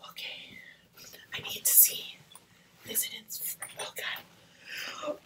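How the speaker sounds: hushed breathless whisper. Real, not acted. Peeking inside the box, whispering. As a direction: whisper in suspense.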